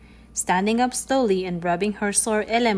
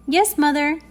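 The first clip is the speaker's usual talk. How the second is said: higher, louder little-girl answer, lightly voiced; grinning reply; 6 semitones higher, 5 dB louder than usual. A narrator's reading. Girl answering her mother's warning, lightly voiced grinning reply. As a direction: answer with a grin, lightly girlish. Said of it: "answered Vili Vaikula with a grin".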